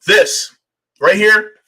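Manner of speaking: loud, pointed opening; loud emphatic. Real, not acted. Angry host to chat, loud emphatic. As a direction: hammer it home loudly. Angry rant about being sold out.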